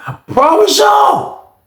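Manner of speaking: very high, slow outraged yell; shocked shout. Real, not acted. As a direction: cry out in disgusted shock. Disgust reaction after finding feces.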